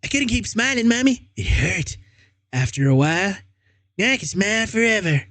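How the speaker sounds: put-on high, eerie childish voice, unsettlingly calm; a disturbed boy explaining himself sweetly to mom; high little-boy voice with creepy calm. Jeff's voice held in the story.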